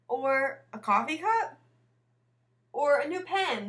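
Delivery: eager suggestions. A narrator's reading. Children brainstorming gift ideas.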